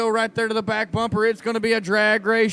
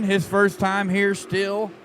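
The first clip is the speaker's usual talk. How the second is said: ordinary talk, nothing marked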